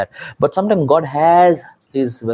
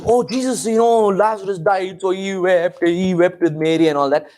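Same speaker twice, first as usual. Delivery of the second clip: mock mournful; 4 semitones higher than usual. Preacher mimicking how many people teach Lazarus's death, mock mournful. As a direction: imitate a sentimental retelling, pitched up.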